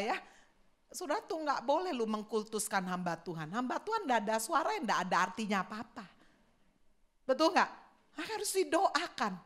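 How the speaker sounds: ordinary talk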